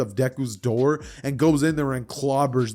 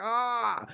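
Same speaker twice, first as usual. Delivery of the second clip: high, drawn-out pained wail; mock cry of pain; 10 semitones higher, 4 dB quieter than usual. Boy holding his head after a hard kick, pained wail.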